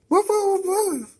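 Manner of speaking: squeaky high-pitched whoa. Pretend. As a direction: squeal it like a startled cartoon. Reacting to game character.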